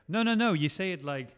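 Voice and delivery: high, loud, overbearing correction; bossy line reading. Acted. Director dictating a line to an actor, bossy and overdone. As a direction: demonstrate the line with exaggerated insistence. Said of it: "But do not just say".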